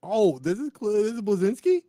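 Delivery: high, loud disbelief; shocked. Real, not acted. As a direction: blurt it out stunned. Stunned at news.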